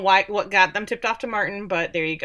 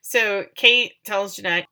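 ordinary talk

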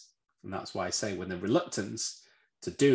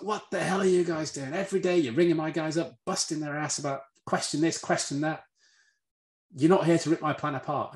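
higher-pitched, indignant retold tirade; irate executive; 7 semitones higher than usual. Big New Yorker confronting the red team, retold in a higher, indignant voice. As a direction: act out an irate boss's complaint.

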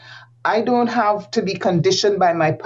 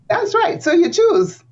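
excited agreement; 11 semitones higher than usual. Real, not acted.

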